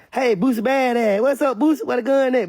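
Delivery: acted police greeting. Police walking up on [name], high mock hailing.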